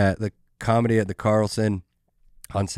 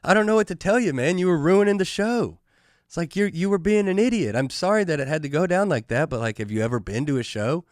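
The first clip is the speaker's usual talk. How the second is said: put-on higher, talking down to a drunk like a patient big brother; a pitched-up, imitated lecturing of himself; mock-reasonable lecture; 6 semitones higher than usual. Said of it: "I'm like".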